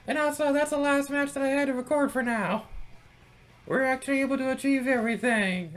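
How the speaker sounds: very high, loud joyful squeal; overjoyed. Real, not acted. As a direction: gush with delighted excitement. Celebrating winning all matches.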